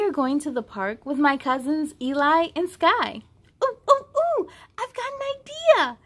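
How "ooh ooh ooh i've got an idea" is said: high, eager lamb voice; bubbling with a new idea; excited. Acted. Myon's voice, excited.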